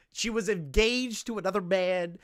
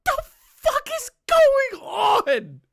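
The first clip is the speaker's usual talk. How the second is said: high, drawn-out, loud scream; shocked shout; 6 dB louder, slower than usual. Real, not acted. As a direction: scream it slowly in total disbelief. Shocked reaction to the story.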